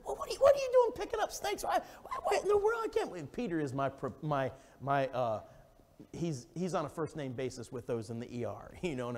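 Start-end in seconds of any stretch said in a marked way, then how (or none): 0.0-3.3 very high, astonished and half laughing; a parent shocked at his son; squealing incredulous outburst; shocked disbelief. Retold or imitated. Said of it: "I said".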